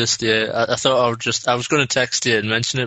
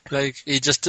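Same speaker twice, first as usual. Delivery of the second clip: usual speaking voice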